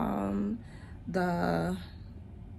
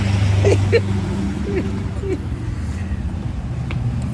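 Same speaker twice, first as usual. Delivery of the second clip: a high, loud burst of laughter; 13 semitones higher, 9 dB louder than usual. Real, not acted. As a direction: crack up at your own slip. Laughing at her own slip.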